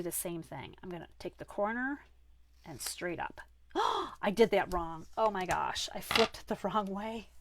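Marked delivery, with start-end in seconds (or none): 3.7-4.2 sharp gasp. Real, not acted. She notices a cutting mistake.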